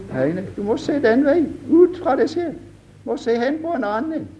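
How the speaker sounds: high, urgent preacher's cry; fervent preaching. Acted. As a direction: preach it out, lifting to the top. Preaching at full force.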